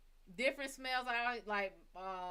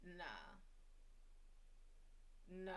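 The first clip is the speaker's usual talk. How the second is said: muttered refusal; 4 semitones lower, 16 dB quieter than usual. Retold or imitated. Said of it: "And I was like".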